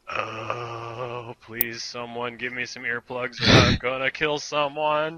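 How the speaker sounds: drawn-out mock groan building to desperation; exasperated pleading. Acting out being driven mad.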